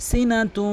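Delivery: সিনাতু said short and quick, vowels not stretched; correct quick pronunciation. Shown as a demonstration. Teacher modelling quick সিনাতু for viewers. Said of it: "দ্রুত উচ্চারণ করবেন".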